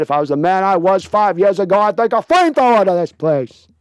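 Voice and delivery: high, loud, ranting movie tough-guy tirade; Al Pacino impression. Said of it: "Here's Al Pacino".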